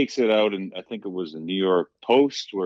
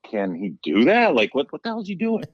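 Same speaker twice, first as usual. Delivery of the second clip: stunned disbelief; 6 semitones higher, 4 dB louder than usual. Retold or imitated. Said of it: "I'm like".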